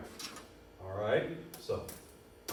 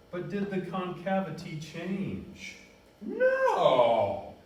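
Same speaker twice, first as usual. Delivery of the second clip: building from a slow high question to a loud high "No!"; excited; 7 semitones higher, 7 dB louder, much slower than usual. Real, not acted. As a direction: let the excitement burst out on the answer. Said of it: "Man, I'm getting so excited".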